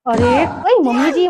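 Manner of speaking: ordinary talk, nothing marked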